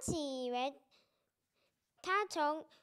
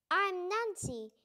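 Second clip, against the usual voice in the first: speaking slowly; 3 semitones higher than usual. Acted. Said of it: "I speak slowly so he will understand".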